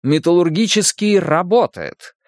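high-pitched, cheering headline read; joyful exclamation. A narrator's reading. Newspaper headline announces the works opening, joyful exclaiming. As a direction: proclaim it gladly, pitched well up. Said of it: "Заголовок радостно восклицал".

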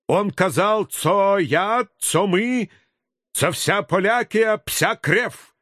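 put-on outraged with Polish accent; angry Polish pan. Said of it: "Усатые паны". (возмущённо, с польским акцентом)

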